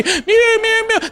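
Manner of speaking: very high squeaky meow; cat meows. Retold or imitated. Kid showing sister the hung cat, squeaky high meows. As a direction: squeak like a trapped kitten.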